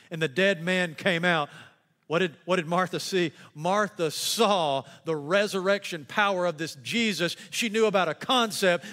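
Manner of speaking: ordinary delivery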